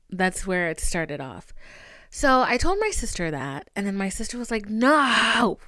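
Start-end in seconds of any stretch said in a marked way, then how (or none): 4.7-5.6 sharp, loud "No!"; loud protest. Retold or imitated. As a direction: shout your objection. Sister reacting in protest.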